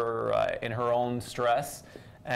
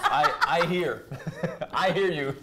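high, louder, breaking into laughter; laughing through it; 5 semitones higher, 3 dB louder than usual. Real, not acted. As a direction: giggle through the words after the slip. Fumbling the phrase, cracking up.

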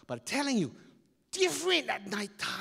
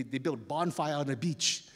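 ordinary delivery

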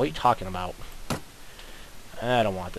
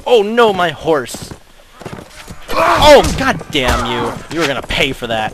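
higher, much louder outcry; angry; 6 semitones higher, 9 dB louder than usual. Real, not acted. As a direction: cry out in outrage. Horse hit in battle.

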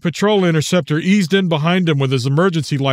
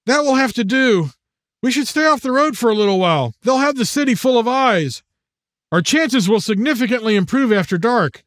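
put-on tense young man voice; 6 semitones higher than usual. Matt voiced as character.

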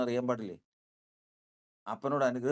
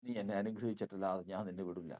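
low, softer pleading vow; soft plea; 5 semitones lower, 7 dB quieter than usual. Acted. Jacob quietly refuses to let the angel go, pleading.

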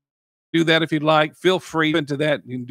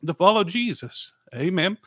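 emotional; 4 semitones higher than usual. Real, not acted. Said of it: "I'm getting emotional".

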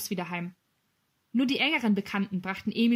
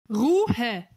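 growled order; 6 dB louder than usual. A narrator's reading. Sergeant demanding silence, growling. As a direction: growl it loudly to shut them up. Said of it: "knurrte der Wachtmeister".

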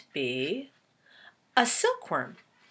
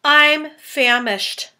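famished grasshopper; 7 semitones higher, 8 dB louder, much slower than usual. Acted. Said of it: "the old green grasshopper said".

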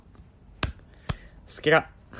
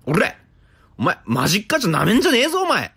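thug taunt; 10 semitones higher, 5 dB louder, faster than usual. Pretend.

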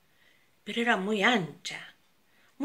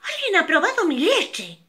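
higher, louder mama bear voice; a higher mama bear, a little less loud than papa; mama bear calling out; 9 semitones higher, 7 dB louder than usual. Acted. Mama bear complains about her milk, higher and louder. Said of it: "gruñó un poco menos fuerte".

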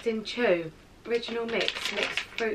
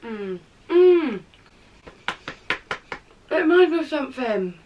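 mumbling between bites; chewing while talking; 3 semitones higher than usual. Real, not acted. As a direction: mouth partly full. Seen 1: Both hold bitten snack cakes, appear chewing after bites; speaker unclear.

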